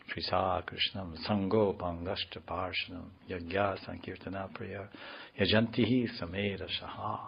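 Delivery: hushed scriptural quotation; verse recitation. Acted. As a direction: recite the verse quietly from memory.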